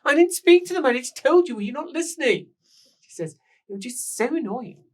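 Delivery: very high, loud and indignant; indignant shriek. Acted. Woman rounding on her attendant, high loud and fed up. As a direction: snap back at the nagging attendant.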